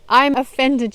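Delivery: loud, high imitation of taking offense; mock irate. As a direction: mimic an upset TV host protesting loudly. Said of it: "so upset and getting so irate".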